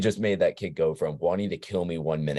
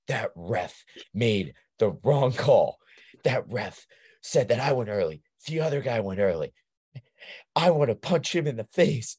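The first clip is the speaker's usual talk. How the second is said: imitated angry kid; 6 semitones higher than usual. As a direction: whine out a kid's outraged complaint to his coach. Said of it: "he gets super mad".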